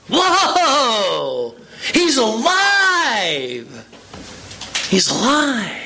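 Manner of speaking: drawn-out, awestruck cry of discovery; mock amazed shout. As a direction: play Mary's sudden joyful realization, stretching alive. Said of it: "she goes".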